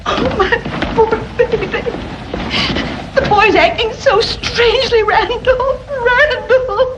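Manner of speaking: high-pitched, weepy worry; tearful distress. Acted. Mother to husband over her sick boy, tearful and distraught. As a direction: wail the name in helpless distress.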